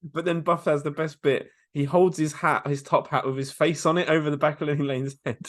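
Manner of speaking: ordinary talk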